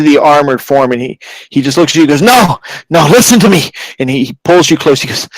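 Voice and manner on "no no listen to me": high, urgent interruption; desperate insistence. Acted. Magistrate pulling the adventurer close, desperate insistence. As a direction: cut in and demand to be heard. Hurt magistrate grabs listener.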